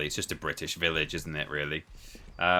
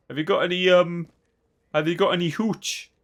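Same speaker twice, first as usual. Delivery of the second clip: gruff northern customer; 12 semitones higher, 7 dB louder than usual. Pretend. Player character addressing the bartender.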